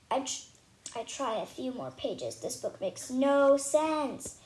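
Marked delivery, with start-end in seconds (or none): 2.3-4.3 each word slow and fed up; slow frustrated complaint. Acted. Girl fed up with a book she cannot read, slowly. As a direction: drag it out, exasperated.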